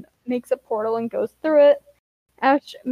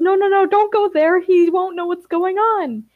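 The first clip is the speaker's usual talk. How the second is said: high, louder panicked protest; freaking out; 7 semitones higher, 4 dB louder than usual. A narrator's reading. Narrator voices Ember stopping Ash going there, freaking out. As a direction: plead against it in a raised, panicky voice. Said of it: "Ember starts freaking out".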